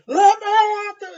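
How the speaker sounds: high, loud and slow roar; furious shout. Acted. Warrior raging at the farmer blocking her, furious shout. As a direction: bellow it, straining against him. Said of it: "she's like".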